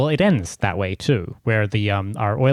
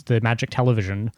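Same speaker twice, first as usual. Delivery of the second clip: ordinary talk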